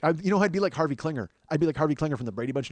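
normal talking voice

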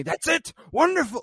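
very high, loud cheer from an acted-out teacher; excited praise. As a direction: cheer the student on, voice leaping up. Acts out teacher cheering student.

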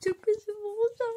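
high, loud, thrilled squeal; excited gushing. Acted. Acting out what the girl will say, high and excited. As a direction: gush it out like it's the best news ever. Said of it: "Sonra bir şey diyecek".

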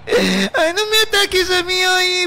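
high, crying protest, drawn out at the end. Acted. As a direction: wail back that he does it too. Wife cries, defends herself. (protesta llorosa y aguda)

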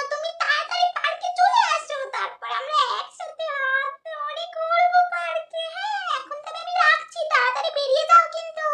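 put-on high, soft sugary young girl; whispery young girl plotting a date; girly little sister voice. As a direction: coo sweetly to her sweetheart on the phone.

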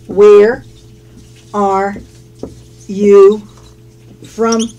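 "Where are you from" with long pauses between words; isolated words, teacher's careful model. Shown as a demonstration. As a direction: dictate it one word at a time. Teacher models question word by word.

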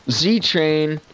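ordinary delivery

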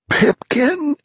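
very high startled cry; high-pitched astonished exclamation; hoarse cry. A narrator's reading. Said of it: "cried everyone hoarsely".

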